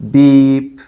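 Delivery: steady, even "Beep"; high and even. Shown as a demonstration. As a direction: say "Beep" held flat like a tone. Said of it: "keep your voice high and even".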